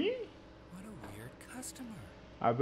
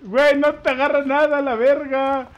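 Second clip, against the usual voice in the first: frustrated gamer; 4 semitones higher, 7 dB louder than usual. Real, not acted. Weapon not hitting anything.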